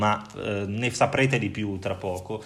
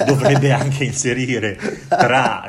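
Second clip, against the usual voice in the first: laughing; 2 semitones higher, 8 dB louder, slower than usual. Real, not acted. Laughs while joking about the curriculum.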